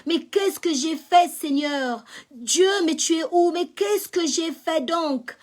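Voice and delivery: loud desperate plea to God; crying out to God. Retold or imitated. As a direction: cry out to God in despair. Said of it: "Je criais".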